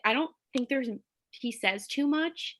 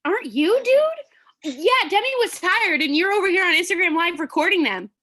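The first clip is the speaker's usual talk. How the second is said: loud mocking rant; 7 semitones higher, 6 dB louder than usual. Real, not acted.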